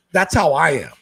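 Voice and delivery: ordinary delivery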